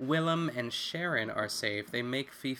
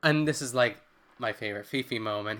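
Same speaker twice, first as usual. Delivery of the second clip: ordinary delivery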